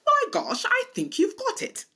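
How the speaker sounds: hammed-up English accent, high and bright. Teacher praising students in a mock English accent. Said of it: "in my best English accent".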